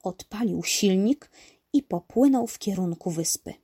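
ordinary delivery

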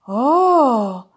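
loud, drawn-out awed "oh"; impressed. A narrator's reading. Dog hears of crocodile skin, impressed. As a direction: exclaim in wonder. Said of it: "Urwis był pod wrażeniem".

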